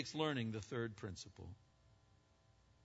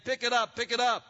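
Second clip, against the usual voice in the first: called out louder and higher; calling out; 9 semitones higher, 9 dB louder than usual. Retold or imitated. Voice from a nearby house calling out. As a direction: call it across from the next house. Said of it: "a voice that was calling".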